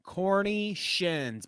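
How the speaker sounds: slow amused "Corny shins"; mock savouring. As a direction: drawl "Corny shins" in disbelief. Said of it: "but it said".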